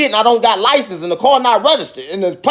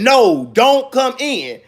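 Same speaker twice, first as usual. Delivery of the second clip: slow, higher-pitched refusal; firm retold refusal; 4 semitones higher, much slower than usual. Man imagining refusing police entry, slow firm refusal. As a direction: spell it out slowly, as a hypothetical answer.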